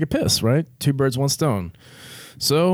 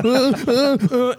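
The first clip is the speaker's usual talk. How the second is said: nervous stammer; 12 semitones higher, slower than usual. Retold or imitated. Stammering in panic.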